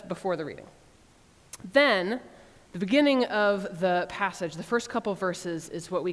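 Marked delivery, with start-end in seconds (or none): none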